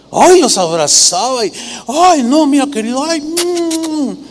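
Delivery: high, gushing hug-and-kiss voice; flattering. Acted. Absalom hugging and kissing a visitor, flattering. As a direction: fawn and coo over a dear one. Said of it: "con ese tono así lisonjero".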